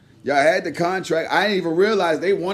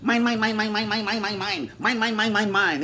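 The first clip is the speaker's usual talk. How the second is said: cartoon seagull imitation; 5 dB quieter than usual. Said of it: "the motherfucker was like".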